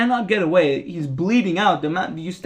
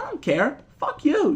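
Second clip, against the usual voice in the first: high, curt mocking brush-off; hammed-up Brotherhood brush-off; mock dismissal; 9 semitones higher than usual. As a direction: toss it off up high. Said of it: "And like".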